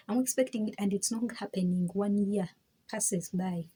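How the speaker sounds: ordinary delivery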